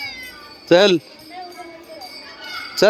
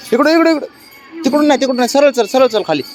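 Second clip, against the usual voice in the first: calling the cat; 9 semitones higher than usual. Real, not acted. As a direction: call out high to steer the pet. Guiding cat which way.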